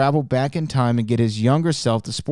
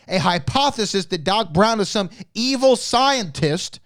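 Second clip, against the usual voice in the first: too emotional; 7 semitones higher than usual. Real, not acted. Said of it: "I'm getting a little bit too emotional".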